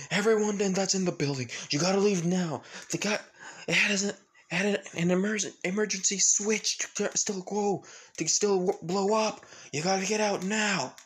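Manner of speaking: high-pitched, panicky evacuation order; urgent warning. Acted. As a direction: rush everyone out, tripping over the words.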